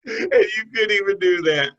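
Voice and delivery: high, loud, through laughter; can barely say it for laughing; laughing disbelief. Real, not acted. Host laughing with co-host over a botched play, laughing disbelief.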